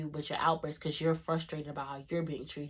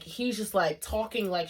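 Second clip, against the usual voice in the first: ordinary talk, nothing marked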